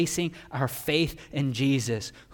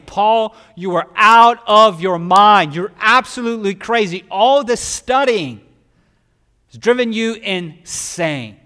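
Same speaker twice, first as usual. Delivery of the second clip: loud, higher, slowing accusation; retold yell; 9 semitones higher, 15 dB louder than usual. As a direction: yell at him as if he's insane. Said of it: "He yells out and says".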